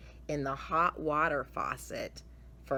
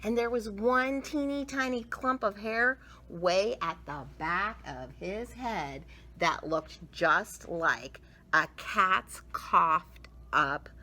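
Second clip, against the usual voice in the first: plain reading voice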